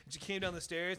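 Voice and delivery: usual speaking voice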